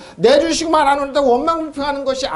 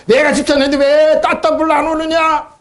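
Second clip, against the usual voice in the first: higher whining voice; mock complainer; 6 semitones higher, 4 dB louder than usual. Preacher voices a believer griping to God about house prices, whining. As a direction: play the greedy homeowner, petulant.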